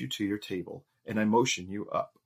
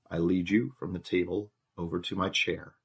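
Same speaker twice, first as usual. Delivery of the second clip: ordinary delivery, nothing marked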